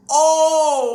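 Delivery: very loud, high "oh"; startled cry. Real, not acted. Host caught off guard mid-sentence, startled cry. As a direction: burst out suddenly at full volume. Startled outburst, then laughing.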